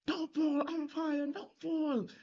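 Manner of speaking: very high-pitched imitation of a cricket fan's chant; heckling supporter. Tuskers supporter appealing to the umpire from the stand, imitated high-pitched call. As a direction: mimic a fan calling to the umpire, way up high. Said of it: "They were very much supporting the Tuskers".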